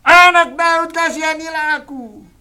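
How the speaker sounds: high, loud desperate cry. Retold or imitated. A voice in the crowd crying to Jesus. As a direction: keep crying out to be heard. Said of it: "suara yang terus berteriak-teriak".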